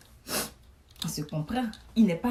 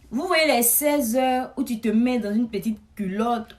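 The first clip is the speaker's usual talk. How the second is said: ordinary talk, nothing marked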